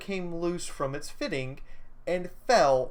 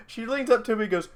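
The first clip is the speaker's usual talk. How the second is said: ordinary talk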